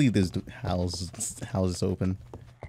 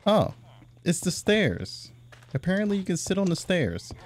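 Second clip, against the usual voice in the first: amused surprise; 10 semitones higher than usual. Real, not acted. Realizes he sat on stairs.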